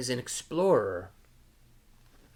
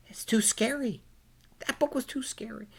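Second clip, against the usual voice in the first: put-on high, worried child voice; too scary; 10 semitones higher, faster than usual. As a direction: say it like a small scared kid. Voicing Pete's fear.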